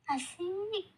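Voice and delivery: put-on bright delighted yelp of a young girl; a child cheering at promised money; excited. Daughter cheering when Mama promises money, excited high yelp.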